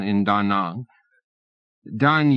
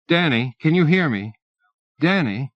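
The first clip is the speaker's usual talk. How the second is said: reach him across the static; anxious calling; 5 semitones higher than usual. A narrator's reading. Operator calling lost Danny over the console, anxious calling.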